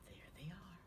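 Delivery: put-on hushed whisper to the kids. Teacher pointing out the jellyfish to children, hushed whisper. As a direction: whisper it like sharing a secret.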